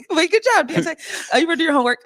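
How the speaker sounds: high, loud, teasing cheer; playful praise. Real, not acted. Actress praises host for doing homework, playfully excited. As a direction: hype them up, high and loud.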